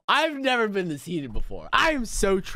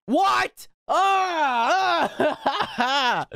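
high, loud shriek breaking into laughter; real high-pitched gamer outburst; disbelieving scream; 12 semitones higher, 4 dB louder, much slower than usual. Real, not acted. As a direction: scream at the failure, then laugh. Fails a good run.

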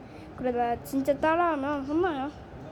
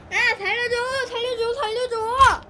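higher, louder playful panic; mock cry for help; 6 semitones higher, 5 dB louder than usual. Caught toy begging the host, mock panic.

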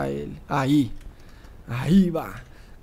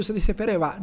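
normal talking voice

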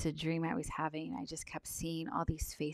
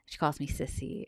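usual speaking voice

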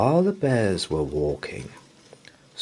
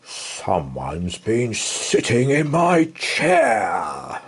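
growling Father Bear; slower than usual. Acted. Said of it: "growled Father Bear".

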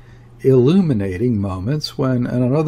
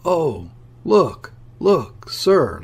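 excited, higher-pitched exclamations; enthusiastic; 6 semitones higher, much slower than usual. A narrator's reading. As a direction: urge him to look, voice jumping up with glee. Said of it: "with enthusiasm".

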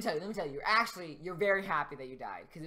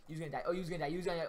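ordinary talk

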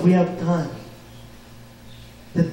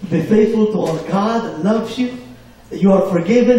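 ordinary talk, nothing marked

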